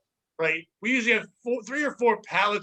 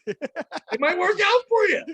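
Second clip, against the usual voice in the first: put-on voice; 10 semitones higher, 4 dB louder, slower than usual.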